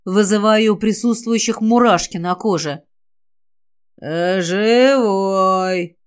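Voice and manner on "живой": long, strained monster moan; eerie, drawn-out creature groan; torn-throat undead voice. A narrator's reading. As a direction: force it through a ruined throat. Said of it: "прорывалось сквозь разорванное горло".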